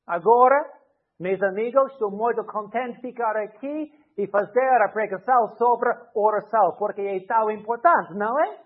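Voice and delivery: bright, lively Portuguese sermon opening; preaching in Portuguese. Shown as a demonstration. Preacher imagines addressing his English church entirely in Portuguese. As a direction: launch into a pulpit greeting in Portuguese. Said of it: "started to preach in Portuguese".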